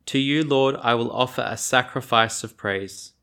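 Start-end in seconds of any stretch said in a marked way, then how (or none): none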